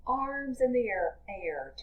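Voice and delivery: normal talking voice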